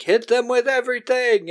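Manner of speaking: high, barked mock order; villain command. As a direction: order the attack. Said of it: "Villain Cop is like".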